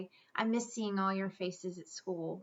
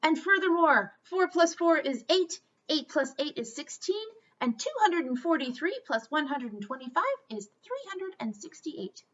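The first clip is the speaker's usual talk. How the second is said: show-off; 6 semitones higher, 5 dB louder than usual. Acted. Camilla showing off math.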